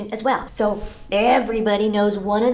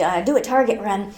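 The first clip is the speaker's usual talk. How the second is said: ordinary talk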